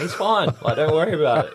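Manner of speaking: high, loud, laughing protest; retold dismissive reassurance. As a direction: wave away their worry with a laugh. Retells brushing off worried owners.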